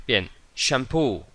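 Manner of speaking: ordinary talk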